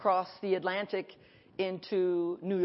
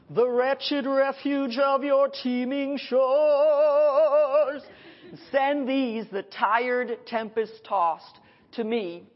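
slow, swelling and loud; soaring slow proclamation; grand poetic recitation; 3 semitones higher, 3 dB louder, slower than usual. Acted. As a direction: declaim it like a monument speaking.

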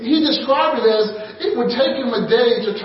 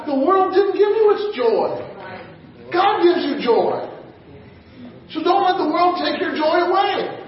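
ordinary talk

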